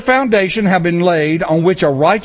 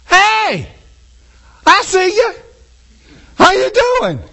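much higher, breezy casual hello; a careless sinner calling across to Jesus; cheerful sinner greeting; 10 semitones higher than usual. Pretend. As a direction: wave hello from a safe distance.

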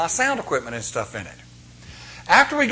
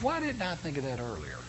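much softer, mused aside; inner thought; 12 dB quieter than usual. Retold or imitated. Preacher recalling his own thought, softly mused. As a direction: murmur it to yourself. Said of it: "I'm thinking to myself".

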